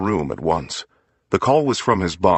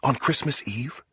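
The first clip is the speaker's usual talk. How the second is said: whispered back; 6 semitones higher, 5 dB quieter than usual. A narrator's reading. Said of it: "Nancy whispered back".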